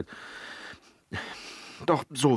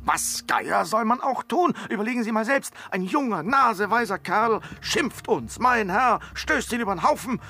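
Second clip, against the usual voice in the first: indignant bragging; 8 semitones higher than usual. Acted. Boastful indignant defence.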